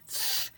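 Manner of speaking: "th" as a bare consonant sound; th sound demo. Shown as a demonstration. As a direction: make the sound "th" alone, not the letters. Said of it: "You make the sound".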